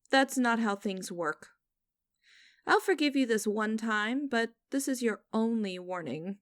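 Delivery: ordinary talk, nothing marked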